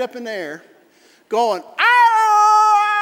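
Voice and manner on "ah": loud, high, long dog wail; dying animal howl. Retold or imitated. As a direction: yowl like a terrified dachshund. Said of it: "sound like an animal was dying".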